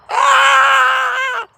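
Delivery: loud, high playful scream; excited yell. Real, not acted. Streamer hyping her chat, gleeful yell. Playful yelling during the hype.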